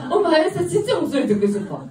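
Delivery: put-on high, loud, eager child's voice; imitated small girl piping up loudly; little daughter. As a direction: play a seven-year-old begging mum. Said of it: "그래서 우리 딸한테".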